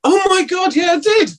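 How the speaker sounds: sudden high delighted outburst, real; a real burst of surprised recognition. Real, not acted. Reminded of a forgotten role.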